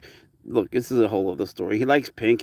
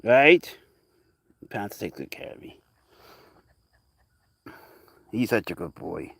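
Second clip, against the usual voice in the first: usual speaking voice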